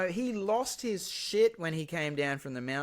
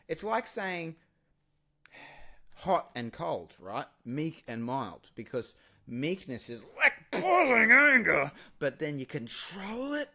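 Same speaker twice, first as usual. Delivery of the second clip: normal talking voice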